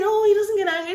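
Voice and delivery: exaggerated, high-pitched dismissal; mock airy denial. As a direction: protest it in a high put-on voice. Retells the woman's repeated denial.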